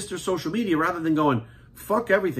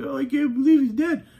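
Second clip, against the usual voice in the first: much higher mock-whiny wail; mocking mourners; 10 semitones higher than usual. Mocking fans who will mourn him, whiny high voice. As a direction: parody the grieving fans, pitch up high. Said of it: "bitching and crying and moaning".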